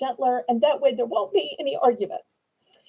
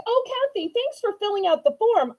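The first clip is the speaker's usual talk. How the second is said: excited greeting; 7 semitones higher than usual. Real, not acted. Greeting a regular bidder in chat.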